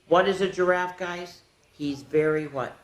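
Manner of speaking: ordinary delivery, nothing marked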